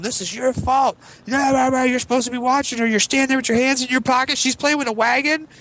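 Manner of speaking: high, scolding wife rant; mock wife scolding the husband the whole ride home; nagging wife. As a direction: berate him like an angry wife.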